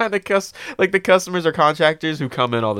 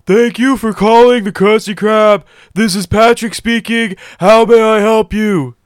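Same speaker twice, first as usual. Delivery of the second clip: loud, high goofy Patrick Star voice, answering the phone; Patrick Star impression; 8 semitones higher, 9 dB louder than usual. Answering the work phone as a joke, in a loud high goofy cartoon voice. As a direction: ham up a dopey cartoon starfish on the phone. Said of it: "Great impression".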